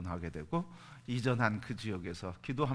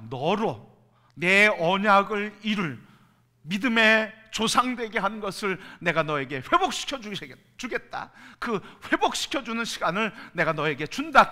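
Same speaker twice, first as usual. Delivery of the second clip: God's voice; 8 semitones higher, 10 dB louder than usual. Acted. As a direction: speak as God proclaiming his promise. Pastor voices God's promise.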